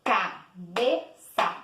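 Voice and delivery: "Cabeça" slowly split, CA, BE, ÇA; slow, a pause after each syllable of "Cabeça". Shown as a demonstration. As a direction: say "Cabeça" in three separated beats. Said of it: "Três vezes".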